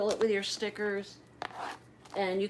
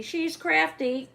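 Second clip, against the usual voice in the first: she's crafty drawn out high and slow, crafty sliding down; teasing sing-song; 8 semitones higher, slower than usual. Acted. Vlogger teasing her crafty viewers, slow playful lilt. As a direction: tease a friend with a slow lilt. Repeats word to tease.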